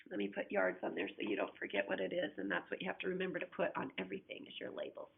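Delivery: ordinary delivery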